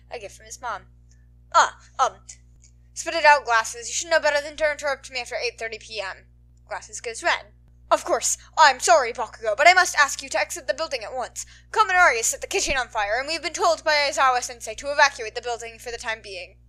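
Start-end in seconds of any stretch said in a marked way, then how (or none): 1.5-6.3 loud, impatient snapping; angry snap. A narrator's reading. Bakugo snaps at Ida for interrupting, loud angry. 7.9-16.6 loud, earnest formal announcing; urgent class rep. A narrator's reading. Ida tells Bakugo to evacuate, loud and earnest.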